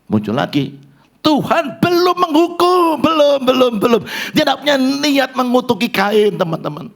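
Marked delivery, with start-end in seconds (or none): 1.2-6.3 high, loud insistent preaching; preaching at full force. Acted. Preacher insisting to the congregation, high and loud. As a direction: hammer home that God has not punished.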